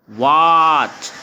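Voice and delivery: वॉच loud and very slow, with a long "aw"; stretched vowel. Shown as a demonstration. Teacher showing the vowel sound, वॉच drawn out loudly. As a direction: hold the vowel of वॉच well before the "ch". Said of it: "यानी अ आ रहा है".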